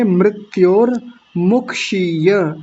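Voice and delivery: slow मृत्योर मुक्षीय with ri and final ya; correct pronunciation. Shown as a demonstration. Teacher modelling the phrase, slow full मृत्योर मुक्षीय. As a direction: separate mrit-yor, muk-shee-ya in मृत्योर मुक्षीय. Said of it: "तो यहाँ क्या बोलेंगे".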